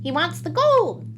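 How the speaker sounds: high, loud, slow little-man voice; leprechaun. A narrator's reading. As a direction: announce it to the band with relish. Leprechaun tells his band.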